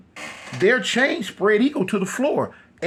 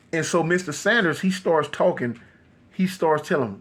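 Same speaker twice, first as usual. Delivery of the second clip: usual speaking voice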